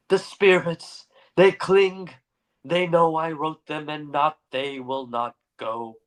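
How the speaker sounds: a raving lost soul pleading; haunted madman voice. Acted. Haunted derelict speaks to narrator.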